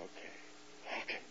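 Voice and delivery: very soft, almost whispered giving in; hushed compliance. Retold or imitated. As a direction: answer the inner voice under your breath. Meekly obeys the inner voice.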